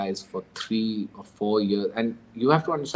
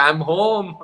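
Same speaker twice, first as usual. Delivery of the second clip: excited announcement; 7 semitones higher, 3 dB louder than usual. Pretend. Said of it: "be like".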